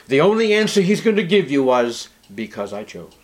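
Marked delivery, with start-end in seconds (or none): 2.3-3.1 quiet voice of God. Acted. God's answer voiced softly.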